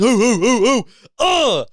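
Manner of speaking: high, hammed-up ooh ooh party whoops; mock drunk cheering whoops. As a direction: imitate a bro whooping after a shotgun. Imitating guy chugging beers.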